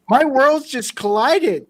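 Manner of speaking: high, loud, laughing amazement; retold mind-blown outburst, breaking into laughter; shocked. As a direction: exclaim it as if stunned and delighted. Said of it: "completely shocked".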